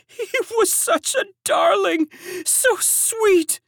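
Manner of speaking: high, loud tearful servant; half-sobbed praise of the lost boy; sobbing. A narrator's reading. Servant mourns the stolen baby, high tearful sob. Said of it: "sobbed another".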